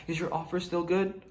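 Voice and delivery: soft, timid asking; sheepish seller. Retold or imitated. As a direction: creep back hesitantly. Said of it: "The seller comes back like that".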